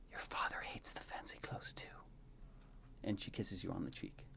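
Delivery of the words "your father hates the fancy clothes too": soft, secretive whisper; whispered confidence. A narrator's reading. Queen leans to her son's ear, whispering. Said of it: "whispers in your ear".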